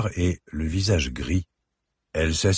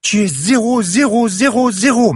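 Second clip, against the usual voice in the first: much higher and louder furious shout, each zéro pounded; hammered screaming; 9 dB louder than usual. Acted. Enraged girl hammers "zéro" at her mother, screaming. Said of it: "martelait-elle".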